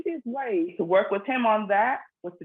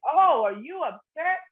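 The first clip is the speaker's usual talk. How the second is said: high, slow, animated "oh, are you upset?"; sing-song concern to a child; animated for kids; 8 semitones higher, slower than usual. Shown as a demonstration. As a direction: mom playing it up for a small child. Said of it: "more animated with it".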